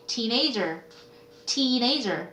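put-on "teenager" with a long, drawn-out ee in teen. Shown as a demonstration. Teacher modelling the correct pronunciation, "teenager" with long ee. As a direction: linger on the first vowel of "teenager". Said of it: "이렇게 발음하셔야 돼요".

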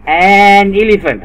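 drawn-out article. Shown as a demonstration. Tutor stresses the article to a child slowly. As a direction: stretch "an elephant" slowly for the child. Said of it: "عشان في إي في الأول".

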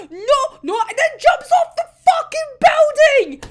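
high, loud shout of disbelief; horrified outburst. Real, not acted. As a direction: yell it in dismay, pitch right up. Upset retelling of the jump.

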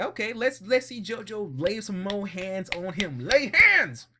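loud, high cheer rising to a yell. Retold or imitated. Viewer cheers Jonathan to hit Dio, loud high cheer. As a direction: egg him on like a fight fan. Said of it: "I was like".

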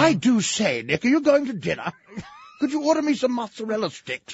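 high mock English accent; posh English tourist. English tourist asking the radio host about dinner, mock English accent. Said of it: "horsey face English people".

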